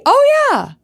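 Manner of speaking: high loud sudden "oh yeah"; sudden realization. Real, not acted. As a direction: it just hit you. Sudden recollection.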